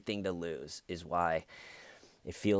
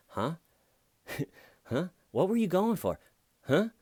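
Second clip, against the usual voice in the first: confused stalling; 11 semitones higher, 4 dB louder than usual. Retold or imitated. Drunk driver deflects cop's question.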